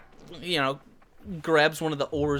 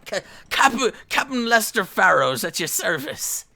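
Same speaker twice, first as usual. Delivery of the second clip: grandly announcing himself with a stammer; a lightly voiced showy sea captain; pompous captain; 4 semitones higher, 5 dB louder than usual. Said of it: "an exaggerated bow".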